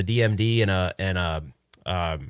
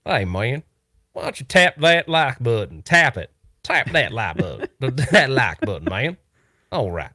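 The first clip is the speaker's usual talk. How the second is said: put-on cowboy twang; Cowboy Retro Ralph. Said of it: "Cowboy Retro Ralph wouldn't say".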